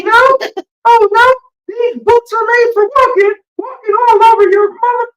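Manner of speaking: very high squeaky cartoon mouse; trash-talk in a piercing falsetto mouse voice; Mickey. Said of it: "It's me, Mickey".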